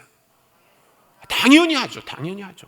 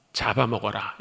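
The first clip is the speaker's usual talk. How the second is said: hushed divine command; 9 semitones lower than usual. Retold or imitated. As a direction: voice God's order low and hushed. God's command voiced in retelling.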